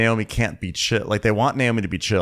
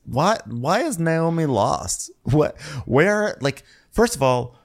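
incredulous; 8 semitones higher, slower than usual. Real, not acted. Incredulous outburst.

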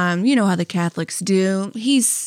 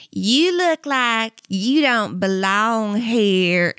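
slow, drawn-out Southern twang, mean under the niceness; mock a sweetly mean Southern drawl; snooty Southern accent; much slower than usual. Mall worker snubbing a shopper in slow, mean-nice Southern drawl. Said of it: "nice Southern way where they're just like".

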